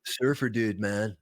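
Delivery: laid-back Californian surfer accent; California surfer dude. Shown as a demonstration. Showing the exception to neutral California, surfer accent. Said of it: "if it's in California".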